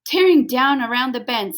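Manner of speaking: ordinary delivery, nothing marked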